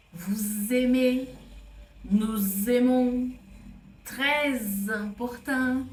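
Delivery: slow and loud, sounding the z between words; "très important" said as "trèz important"; linking z demo. Shown as a demonstration. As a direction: link "Vous aimez" with a clear z. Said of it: "vamos de novo pra você prestar atenção".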